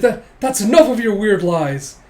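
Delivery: high, loud, stammered rebuke; mock-indignant outburst; flustered scolding. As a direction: shut down a liar, flustered. Acted quarrel.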